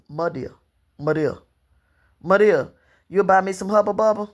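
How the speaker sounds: put-on a light childlike plea saying "my dear" without the d, as "muh deel"; wheedling child. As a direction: coax grandmother for gum, lightly childlike. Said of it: "all them D's, and it was".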